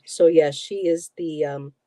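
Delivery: normal talking voice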